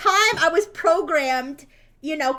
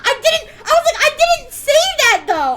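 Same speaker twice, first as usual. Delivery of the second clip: high, loud, laughing protest; laughing through it; 9 semitones higher, 9 dB louder than usual. Real, not acted. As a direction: defend yourself while cracking up. Laughing while defending herself.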